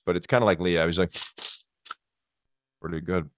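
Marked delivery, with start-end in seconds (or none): 2.8-3.3 lightly done tough-guy mumble; quiet, low De Niro menace; De Niro impression. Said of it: "and then De Niro".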